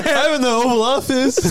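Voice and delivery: high, giddy pretend boast; laughing mock glee. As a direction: crack up while putting it on. Joking fantasy amid laughter.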